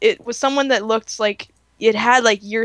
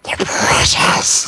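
slow, whispered rasping creature voice; Smeagol; much slower than usual. Pretend. Friend joins in the impression, slow creepy whisper. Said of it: "me doing a Smeagol impression".

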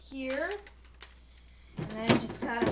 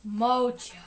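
ordinary talk

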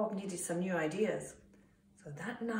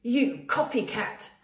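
slow, loud accusation; indignant shout; 3 semitones higher, 6 dB louder than usual. A narrator's reading. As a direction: hurl it out slowly and loudly. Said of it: "shouted Roy".

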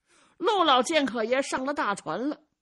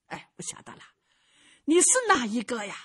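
put-on crafty old man; faster than usual. Old swordsman figure speaks.

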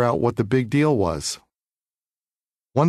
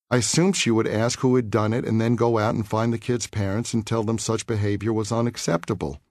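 plain reading voice

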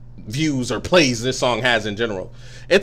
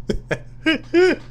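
laughing; 11 semitones higher, much slower than usual. Real, not acted. Laughing hard at the song.

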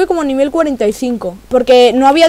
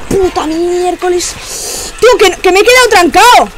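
loud and higher, exasperated; frustrated shout; 6 semitones higher, 4 dB louder, slower than usual. Real, not acted. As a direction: yell it out in frustration. Stuck in the game, upset.

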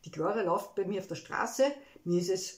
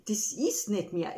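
normal talking voice

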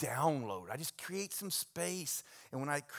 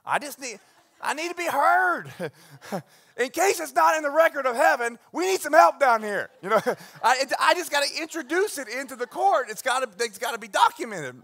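much higher and louder, laughing through it; fired-up insistence; 11 semitones higher, 14 dB louder than usual. Real, not acted. Pastor joking to the congregation about needing to be heard, loud and high. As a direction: push it out, demanding to be heard.

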